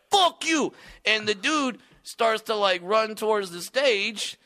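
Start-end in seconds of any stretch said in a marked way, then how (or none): 0.1-0.7 high, loud fuck you; angry heckler. Retold or imitated. Heckler yelling back at the comic, high and loud. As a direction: spit it back, higher and louder. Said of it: "He's like".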